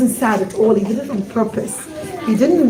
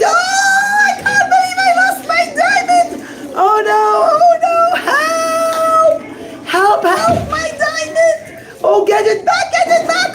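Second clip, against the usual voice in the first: hammed-up screaming. Rabbi pretends to lose the diamond, hammed-up screaming. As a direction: wail over the lost diamond, overacted. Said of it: "he starts to scream".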